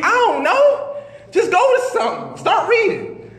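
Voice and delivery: high, urgent pleading; frantic urging. Retold or imitated. Son begs his mother to read the Quran, high frantic urging. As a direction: push her to read, near panic.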